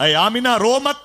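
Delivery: ऐ आमिना रो मत called out high and loud, rising on मत; ऐ आमिना रो मत as a rousing high cry. Acted. Climax of the divine consolation.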